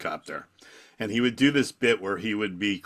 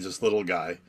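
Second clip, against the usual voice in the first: normal talking voice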